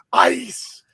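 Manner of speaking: high, sharp stadium shout of ice; imitating the vendor call. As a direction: yell ice like the beer man. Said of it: "and you'd hear".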